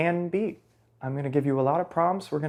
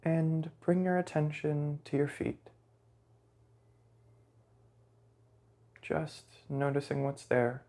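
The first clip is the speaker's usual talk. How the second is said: softer meditation voice, long silences between phrases; guided meditation; 6 dB quieter than usual. Real, not acted. Guide leading listeners through a body scan, soft guided meditation. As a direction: guide them gently, leaving lots of space.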